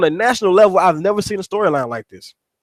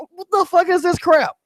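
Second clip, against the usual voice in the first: mock-agitated, high incredulous questioning; 9 semitones higher than usual. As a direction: replay your agitated disbelief, pitch jumping up. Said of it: "I was getting agitated".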